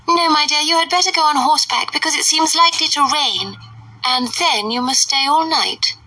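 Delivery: high, fluttery mother's voice; fussy Mrs Bennet. A narrator's reading. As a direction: play the indignant silly mother.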